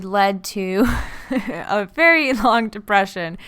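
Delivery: awkward laugh breaking into the sentence; slow, with a real laugh slipping out. Real, not acted. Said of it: "don't know why I'm laughing".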